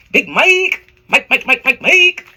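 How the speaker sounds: loud, high, repeated name with rising end; summoning call. Pretend. Psychic conjuring Mike's spirit, loud rising holler. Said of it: "say it like that".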